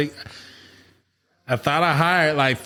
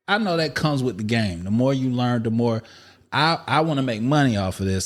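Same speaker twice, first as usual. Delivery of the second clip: ordinary talk, nothing marked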